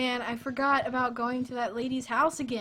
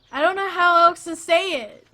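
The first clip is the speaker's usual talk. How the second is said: high, loud, exasperated outburst; real worked-up protest breaking into laughter; 7 semitones higher, 13 dB louder than usual. Real, not acted. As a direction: protest helplessly at the top of the voice. Protesting teasing about pronunciation.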